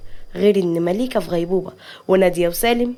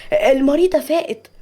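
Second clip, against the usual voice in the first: higher-pitched happy announcement; glad, raised-pitch nurse; joyful; 6 semitones higher than usual. Acted. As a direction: break the good news with delight. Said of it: "الممرضة بفرحة".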